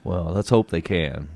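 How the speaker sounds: usual speaking voice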